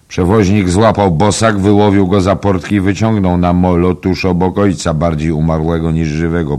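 loud, high-pitched, animated account; excited storytelling. A narrator's reading. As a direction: tell the rescue at full voice, easing slightly. Interrupter tells the rescue excitedly. (głośna, ożywiona opowieść)

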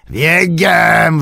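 high, drawn-out orc yell; screamed command. A narrator's reading. Orc driver whipping the troop into a run, screaming. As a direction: scream the order with full force. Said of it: "wrzeszczał, śmiejąc się".

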